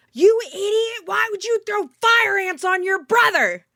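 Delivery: high, loud scolding yell; outraged dad. Retold or imitated. Storyteller as dad scolding kid over fire ants, outraged yell. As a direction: imitate a neighbor dad yelling in outrage at his child. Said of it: "I hear my neighbor, the dad".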